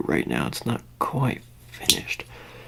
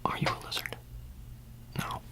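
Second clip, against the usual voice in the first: very soft, near-whispered questions; hushed roleplay questioning; 4 semitones higher, 12 dB quieter, faster than usual. Pretend. Talking to someone about lizards among them, hushed questioning. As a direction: drop to a whisper, much softer.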